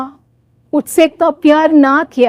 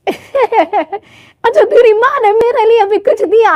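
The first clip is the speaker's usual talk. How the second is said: greedy sister-in-law; 6 semitones higher than usual. Acted.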